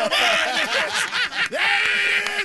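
high, loud, drawn-out triumph; triumphant. Acted. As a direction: crow it over the crowd's laughter. Celebrates his own punchline over laughter.

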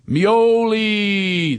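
high, drawn-out call of his last name; calling out Mioli. Shown as a demonstration. Calling his friend by last name, drawn-out high hail. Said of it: "This is me calling him".

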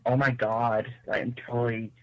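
imitation American accent from a British caller; British speaker trying out American. Shown as a demonstration. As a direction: put on a ditzy American accent. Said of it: "I can kind of be like".

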